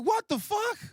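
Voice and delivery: very high incredulous squeal; outraged disbelief. Acted. Wounded man to friend refusing hospital, outraged disbelief. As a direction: shoot right up in pitch, incredulous.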